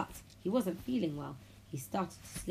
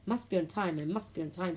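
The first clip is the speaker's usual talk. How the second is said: ordinary delivery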